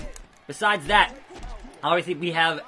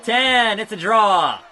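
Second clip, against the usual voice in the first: loud, high play-by-play; hyped count along; excited callout; 6 semitones higher, 7 dB louder, slower than usual. Real, not acted. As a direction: call the moves as they land. Calling game action.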